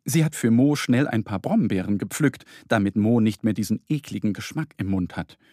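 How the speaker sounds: usual speaking voice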